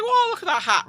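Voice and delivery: high, bright mock awe; impressed mock gasp. Imitating boyfriend gawking at coronation, high mock wonder. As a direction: squeal like you're dazzled by a hat. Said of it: "Daniel being like".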